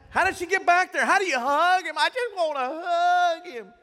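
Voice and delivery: high, loud, dreamy longing, slowing down; swooning fan. Retold or imitated. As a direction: gush like a starstruck teen. Voicing daughter's longing backstage.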